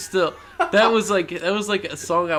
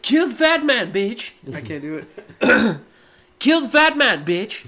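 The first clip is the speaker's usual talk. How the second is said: Stewie voice; 6 semitones higher, 5 dB louder than usual. Retold or imitated. Stewie impression attempts.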